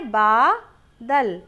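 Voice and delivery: बादल said slowly and firmly, falling at the end; slow emphatic answer. Shown as a demonstration. Teacher giving the children the right word, slow and firm. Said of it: "तो सही शब्द क्या है?".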